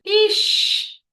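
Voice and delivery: high, loud "Ixi!" of alarm; sudden high, loud exclamation of dismay; mock alarm. Real, not acted. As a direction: yelp it out like bad news. Exclaims about body reacting.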